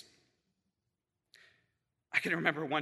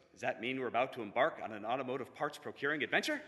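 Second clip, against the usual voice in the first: low, quiet, untroubled question; unfazed; 5 semitones lower, 7 dB quieter than usual. Retold or imitated. Friend responding to the broken belt, unfazed. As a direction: ask it coolly, as if nothing happened. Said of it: "unfazed, just goes".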